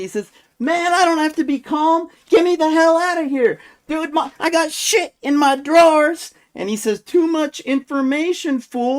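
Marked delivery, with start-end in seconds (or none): 0.6-6.3 high, loud imitation of an irate detainee; mock outraged yelling at a coworker; extremely irate. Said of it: "this guy was extremely irate". 7.0-9.0 high-pitched mock guard retort; playfully acted protest; guard's objection. Retelling guard's disgusted reply.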